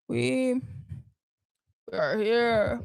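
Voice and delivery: halting presidential phrasing; tentative politician impression with long pauses; Barack Obama. Co-host attempting a president for host, halting impression. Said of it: "Barack Obama?".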